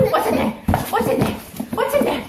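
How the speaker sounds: high sing-song voice for the puppy. Real, not acted. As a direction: coo at the dog in a high playful voice. Talking to dog, excited high voice.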